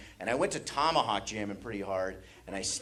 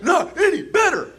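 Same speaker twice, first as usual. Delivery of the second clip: very high, loud taunt; high loud mimicking jeer; mocking taunt; 9 dB louder than usual. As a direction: taunt a gasping little brother. Taunting wheezing brother, mimicked.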